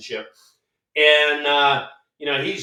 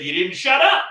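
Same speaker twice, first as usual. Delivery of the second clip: high, louder retold snap back; retort; 9 semitones higher, 5 dB louder than usual. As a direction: fire it back, pitched up. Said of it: "I said".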